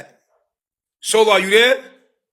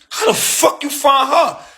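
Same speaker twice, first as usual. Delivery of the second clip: incredulous; 7 semitones higher, 3 dB louder than usual. Retold or imitated. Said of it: "I'm like".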